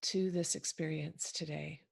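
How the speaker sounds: measured phrases with long, settling pauses; unhurried meditation leader, softening as it goes; guided meditation. Acted. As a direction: ease listeners gently into the present moment. Leads listeners into a guided meditation.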